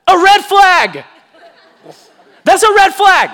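loud, high shout of warning; emphatic call-out. Real, not acted. Preacher flags the danger to his congregation, loud shout. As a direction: holler it out like an alarm.

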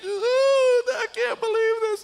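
high, hammed-up tearful wail; imitated crying. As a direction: mimic a sobbing kid in disbelief. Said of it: "I see him crying".

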